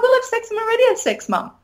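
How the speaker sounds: high, fast, lightly mocking teen voice; imitating her kids. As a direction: toss it off breezily like a teenager telling mum. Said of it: "my kids are like".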